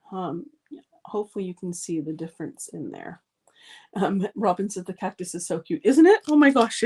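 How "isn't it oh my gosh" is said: high, loud squeal of delight; a burst of excited cooing; gushing delight. Real, not acted. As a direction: gush over how cute it is. Gushing over a viewer's comment.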